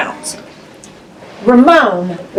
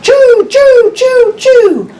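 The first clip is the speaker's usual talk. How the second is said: high, loud choo-choo; train whistle; 11 semitones higher, 3 dB louder, slower than usual. Pretend. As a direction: call out like a train. Train sound in picture book.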